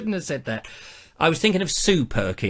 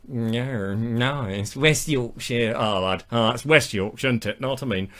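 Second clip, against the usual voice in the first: put-on West Yorkshire accent; 7 semitones lower, 3 dB quieter than usual. Playing locals who insist on their county, mock West Yorkshire accent. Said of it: "I can't do a Mancunian accent".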